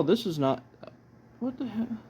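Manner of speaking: usual speaking voice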